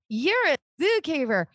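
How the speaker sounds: high, hammed-up proclamation; mock announcement. Psychic refusing to tell a banker her calling, mock proclaiming. Mocks the message she refuses to give.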